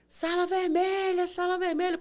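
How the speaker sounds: loud, high call to clear the corridor. Retold or imitated. Nurse rushing the stretcher to the red room, loud urgent call. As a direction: yell it down the hallway.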